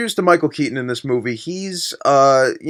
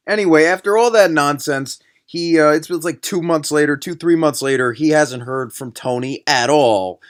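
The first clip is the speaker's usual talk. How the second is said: ordinary talk, nothing marked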